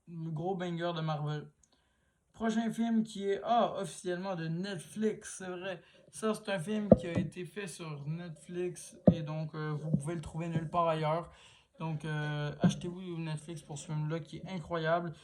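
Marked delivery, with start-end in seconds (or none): none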